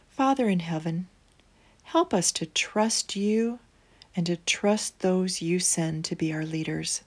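soft, hushed bedtime prayer. Acted. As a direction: pray gently, near a whisper at the end.

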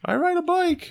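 very high falsetto woman's voice; Meryl Streep. Acted. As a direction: float it up in a mock-female falsetto. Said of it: "she's like".

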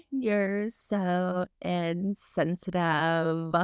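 slow, drawn-out mock scold; mock offended. Sister teasing the host for being rude, mock offended. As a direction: tease her like she's shocked.

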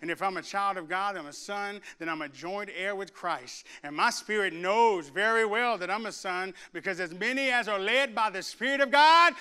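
usual speaking voice

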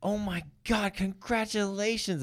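high, slow gushing congratulations; high, drawn-out mock excited lady; excited stranger. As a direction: gush like a thrilled stranger. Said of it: "just turns to us".

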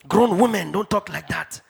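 ordinary talk, nothing marked

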